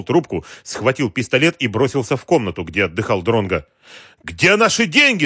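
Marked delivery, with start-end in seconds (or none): 4.2-5.3 high-pitched, furious demand; angry yell. A narrator's reading. Boss storming in for his money, angry yell. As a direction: yell it as you burst in. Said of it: "заорал он".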